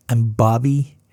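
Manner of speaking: usual speaking voice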